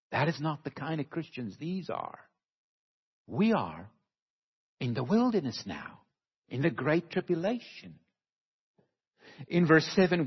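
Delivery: ordinary delivery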